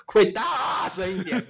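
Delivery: high, slow, laughing plea; laughing request. Retold or imitated. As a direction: stretch it out with a laugh. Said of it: "我就说".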